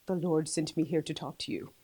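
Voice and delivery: low, hushed voice with attempted Bahamian accent; imitated quiet preacher. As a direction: drop low and soft, imitating a solemn messenger. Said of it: "he got really, really quiet".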